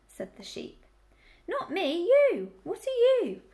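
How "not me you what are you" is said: high, loud piglet voice; insistent piglet. A narrator's reading. Piglet correcting the muddled sheep, insistent. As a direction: push hard on YOU, pitched right up.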